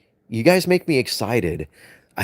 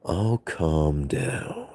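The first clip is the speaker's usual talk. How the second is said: calming down; 4 semitones lower, 8 dB quieter, much slower than usual. Shown as a demonstration.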